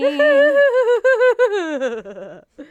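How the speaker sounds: high wailing fake sob; hammed-up ghostly boo-hoo; fake crying. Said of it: "That's my fake crying".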